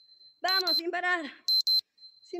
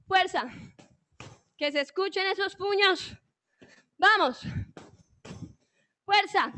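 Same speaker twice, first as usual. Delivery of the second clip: loud, higher pushing calls; coach shouting; 4 semitones higher, 4 dB louder than usual. Real, not acted. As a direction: drive them through the burpees. Coach urging during burpees.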